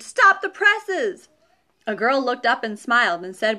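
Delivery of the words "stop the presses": high, slow, loud bellow; stretched-out hammy holler. A narrator's reading. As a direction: holler it like a newsroom boss. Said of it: "Mister Owens hollered".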